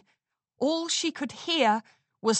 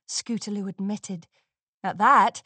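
ordinary delivery, nothing marked

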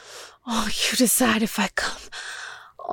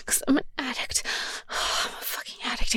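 softer, ashamed hush; confessing whisper; 7 dB quieter, faster than usual. Acted. Admitting her need to her partner, whispered. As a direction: drop into a guilty whisper.

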